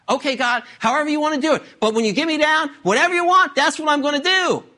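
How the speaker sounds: high, anxious promises; hammed-up eager plea; eager bargaining. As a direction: agree to anything, desperate for rescue. Acting Jack eagerly promising anything to God.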